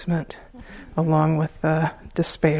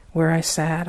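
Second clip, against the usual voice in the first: ordinary talk, nothing marked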